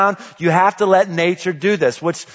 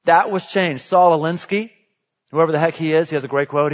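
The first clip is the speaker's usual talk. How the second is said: normal talking voice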